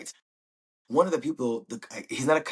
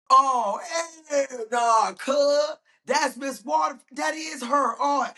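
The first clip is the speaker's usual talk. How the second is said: outraged shouting; slower than usual. Retold or imitated. Train passengers spot Missus Waterford, shouting in outrage. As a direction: shriek it like a furious crowd.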